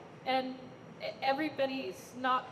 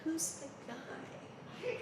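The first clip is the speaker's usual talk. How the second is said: imitated hushed, drawn-out question, pitched up; hushed intrigue; 5 semitones higher, 11 dB quieter, much slower than usual. Woman narrating a show spots a newcomer, hushed intrigue. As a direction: ask very softly and slowly, rising at the end.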